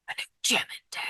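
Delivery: hushed, voiceless whisper; whispered echo. Real, not acted. Speaker confiding news of the new gym to viewers, whispered. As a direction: whisper it like a secret aside.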